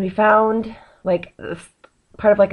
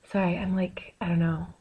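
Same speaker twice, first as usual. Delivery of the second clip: whispered trailing off; 8 dB quieter than usual. Real, not acted. Trailing off, self-conscious.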